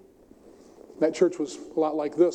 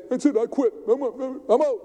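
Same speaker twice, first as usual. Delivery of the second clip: fed-up huffy voice, pitched higher; mock quitting; 7 semitones higher than usual. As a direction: throw up your hands and storm off. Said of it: "And we say".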